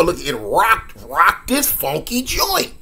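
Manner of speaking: usual speaking voice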